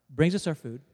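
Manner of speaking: ordinary talk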